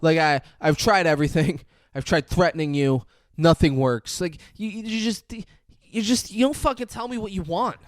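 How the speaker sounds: hammed-up desperate ex on the phone; desperate rant. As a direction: plead and rage at once. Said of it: "and he's like".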